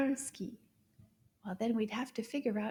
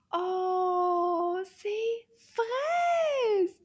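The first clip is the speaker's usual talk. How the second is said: delighted reveal; 7 semitones higher, 6 dB louder, much slower than usual. Acted. Reveals strawberry.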